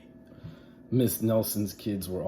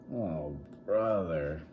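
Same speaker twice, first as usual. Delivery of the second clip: bored class; 3 semitones lower, 4 dB quieter, slower than usual. Acted. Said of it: "said the class".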